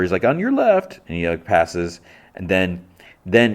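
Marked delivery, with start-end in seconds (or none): 0.2-1.0 high-pitched mock call. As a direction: call out lightly as a passing cyclist. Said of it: "he, like, calls out to her".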